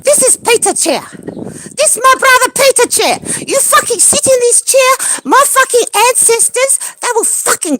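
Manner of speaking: high, loud threat; enraged yelling; furious shout. Real, not acted. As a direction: warn them off. Threatening anyone using chair.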